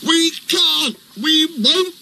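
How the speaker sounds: high, whiny trucks refusing; stubborn trucks. A narrator's reading.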